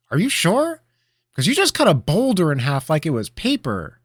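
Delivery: put-on high, loud, eager boy voice; eager apprentice. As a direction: press him, all wide-eyed. Apprentice eagerly presses his master.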